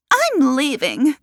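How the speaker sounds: high, loud, drawn-out indignation; defiant announcement. A narrator's reading. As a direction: announce a furious exit. Guest storms off in a quarrel.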